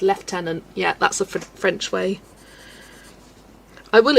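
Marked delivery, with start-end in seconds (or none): 0.0-0.6 lieutenant said as "leftenant"; lieutenant with an F sound, British; lieutenant as "lef-tenant". Shown as a demonstration. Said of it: "Say this word".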